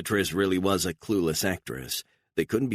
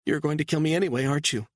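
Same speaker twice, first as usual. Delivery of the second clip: high, begging woman's voice; pleading mother; 10 semitones higher, much faster than usual. A narrator's reading. Mother begging her captor to let her go, high and pleading. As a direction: plead for your daughter's sake.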